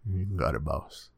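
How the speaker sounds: a dutiful henchman answering the boss; NPC voice. Retold or imitated. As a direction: mumble it like a game henchman. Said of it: "the N P C voice".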